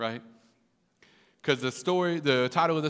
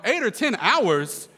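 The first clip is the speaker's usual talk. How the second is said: slow, high, loud echo in disbelief; incredulous; 7 semitones higher, 5 dB louder, slower than usual. Retold or imitated. Reluctant cousin hearing the fishing trip's length, incredulous. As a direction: stretch out the repeat as if outraged. Repeats their figure in disbelief.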